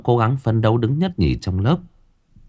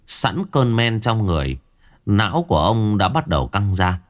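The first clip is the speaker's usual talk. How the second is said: ordinary delivery, nothing marked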